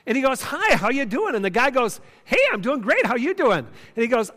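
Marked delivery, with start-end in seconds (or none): 0.4-1.4 higher-pitched friendly hello, imitated; friendly greeting. Diner stops a passing stranger, imitated friendly greeting. 2.2-3.6 imitated upbeat, higher-pitched reply; friendly reply. Stranger answers the diner's hello, imitated friendly reply.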